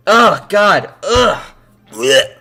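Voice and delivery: loud high ugh of frustration; loud exasperated blech; disgusted groan. Real, not acted. Frustrated outburst while editing.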